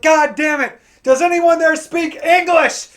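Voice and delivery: loud, high angry outburst; fed up. Retold or imitated. Fed-up customer yelling at call center agent, exasperated. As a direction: shout it in exasperation, drawing out the demand. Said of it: "I got fed up".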